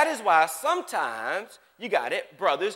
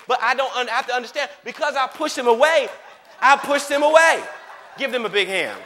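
louder, higher, building preaching; full-force preaching; 5 semitones higher, 6 dB louder than usual. Acted. Preacher pressing the congregation, loud and fervent. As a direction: drive the point home to the congregation.

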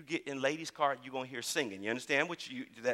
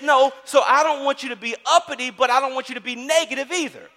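fervent preaching; 8 semitones higher, 11 dB louder than usual. Acted. Preaching God's words.

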